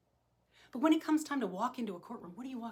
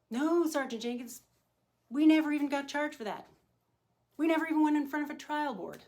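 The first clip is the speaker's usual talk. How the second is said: higher, insistent mock protest; protesting officers; 6 semitones higher, 4 dB louder than usual. Sergeant mimicking officers who excuse themselves, mock protesting. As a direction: imitate officers pleading their innocence. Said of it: "And I've heard it all".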